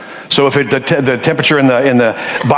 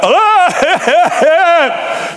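high, drawn-out mock wail; imitated loud weeping; 14 semitones higher than usual. Preacher shows how he wept aloud, mock wailing. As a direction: wail aloud in broken sobs, hammed-up. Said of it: "he wept".

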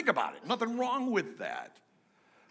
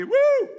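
high, loud joyful whoop; exultant whoop; 8 dB louder than usual. Real, not acted. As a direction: let out a big happy yell. Exultant whoop after the hymn.

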